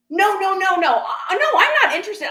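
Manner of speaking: high, loud mock protest; hasty denial. [name] denying she wants to buy anything, over-insistent.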